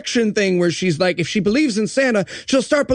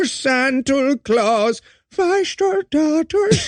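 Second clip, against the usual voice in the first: mock child song; 5 semitones higher, much slower than usual. Mock Dutch girl singing.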